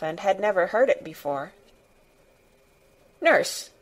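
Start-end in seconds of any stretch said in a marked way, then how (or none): 3.2-3.7 high, sharp summons; imperious one-word order, pitched up; commanding. A narrator's reading. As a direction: call the nurse like a bossy girl. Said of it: "she commanded".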